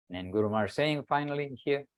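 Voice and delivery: ordinary talk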